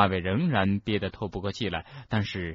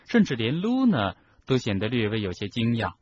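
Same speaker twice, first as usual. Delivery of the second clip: ordinary delivery, nothing marked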